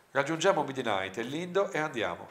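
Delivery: ordinary delivery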